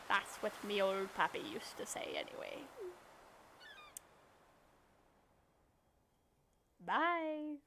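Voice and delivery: put-on a touch of a pirate recalling his old pappy; lightly voiced sailor signing off; Willy pirate voice. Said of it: "I'm gonna give him a pirate voice".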